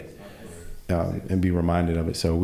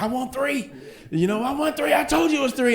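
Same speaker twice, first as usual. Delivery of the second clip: much higher, squeaky mock-wife voice; mock nagging wife. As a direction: parody a petulant wife demanding three. Mimics wife nagging at dinner.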